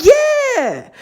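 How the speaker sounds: a very high, drawn-out "Yeah."; sheepish yeah. Retold or imitated. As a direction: own up with a high squeak. Admitting to the tattoos, sheepish.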